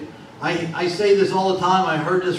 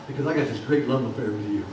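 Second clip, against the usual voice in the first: God speaking; 9 semitones lower, 7 dB quieter, much faster than usual. Acted. Preacher voicing God, tender.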